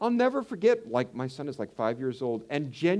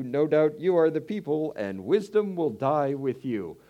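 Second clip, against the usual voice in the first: sarcastic retort; 3 semitones higher, 5 dB louder than usual. Acted. Job's sarcastic retort read.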